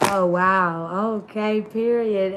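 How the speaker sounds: ordinary talk, nothing marked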